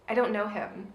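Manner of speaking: quiet, loaded pause before the line; dramatic pause delivery. Retold or imitated. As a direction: hold a beat then drop it softly. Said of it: "She needed a pause".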